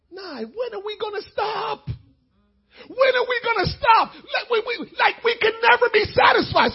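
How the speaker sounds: high, loud, tearful outcry to son; exasperated outcry. Retold or imitated. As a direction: cry out at the endless wanting. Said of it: "I said to him".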